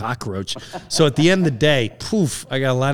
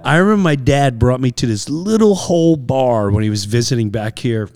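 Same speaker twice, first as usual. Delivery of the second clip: ordinary talk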